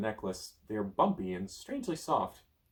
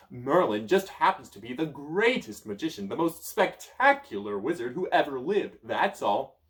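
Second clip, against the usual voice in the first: loud, high bragging; boasting wizard; 3 semitones higher, 6 dB louder than usual. Acted. Merlin boasting to the child, loud. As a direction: puff himself up.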